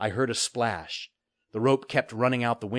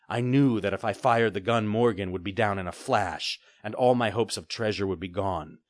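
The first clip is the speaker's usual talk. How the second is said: ordinary delivery, nothing marked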